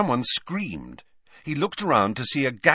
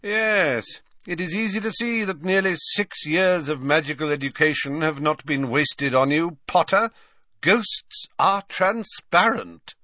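mocking sarcasm; 8 semitones higher than usual. A narrator's reading. Snape mocks Harry's answer.

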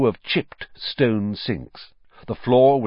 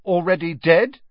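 helpful prompt, higher pitched; 9 semitones higher, 4 dB louder than usual. A narrator's reading. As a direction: offer the missing words helpfully. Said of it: "said Ron helpfully".

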